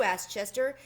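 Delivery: ordinary delivery, nothing marked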